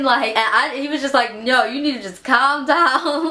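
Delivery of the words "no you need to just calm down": friend's voice. Retold or imitated. Friend voiced telling her calm down.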